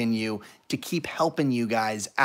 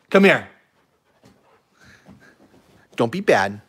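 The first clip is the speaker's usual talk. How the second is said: usual speaking voice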